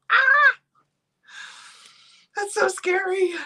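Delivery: high, loud little shriek of mock alarm; mock-scared squeal. Real, not acted. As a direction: yelp as if the number frightens you. Reacting to child turning twenty-one.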